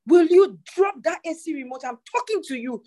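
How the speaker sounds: put-on mother snapping at a child who is ignoring her. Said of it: "if I did not raise my voice".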